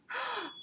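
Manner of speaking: a sharp, high intake of breath; sharp gasp. Acted. Lily gasping when the teacher says not now. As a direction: gasp in dismay.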